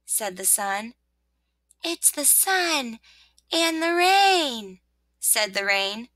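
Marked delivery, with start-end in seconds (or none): 1.8-4.8 high, slow announcement; rain's little voice. Acted. Rain telling rosebud who is there, drawn out. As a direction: draw it out in a high voice.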